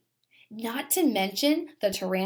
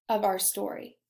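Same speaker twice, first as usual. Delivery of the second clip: plain reading voice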